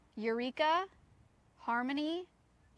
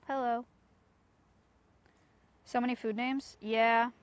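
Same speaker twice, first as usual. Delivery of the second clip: ordinary talk, nothing marked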